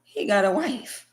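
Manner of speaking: normal talking voice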